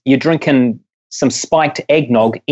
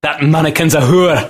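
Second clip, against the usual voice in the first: hammed-up Scottish indignation; Scottish accent. Mock outrage about a shop mannequin, Scottish accent. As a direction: put on a comic Scottish accent. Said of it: "funnier than a Scottish accent".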